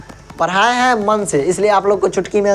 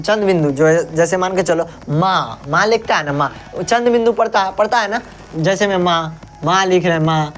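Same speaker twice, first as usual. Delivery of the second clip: ordinary talk, nothing marked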